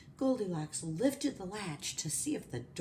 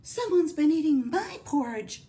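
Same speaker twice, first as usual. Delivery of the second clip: higher, louder middle-sized bear voice; Mama Bear; 9 semitones higher, 8 dB louder than usual. Acted. Mama Bear finds her porridge eaten, middle-sized voice. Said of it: "in a middle, mellow-sized voice".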